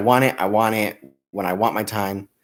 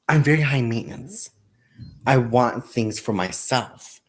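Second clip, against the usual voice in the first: usual speaking voice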